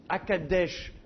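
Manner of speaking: « à Kadesh » posé lentement, appui sur « desh »; détache « à Kadesh » en accentuant la dernière syllabe, « desh »; accent final. Shown as a demonstration. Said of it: "il tombe sur la dernière syllabe". (accent tonique final sur « Kadesh »)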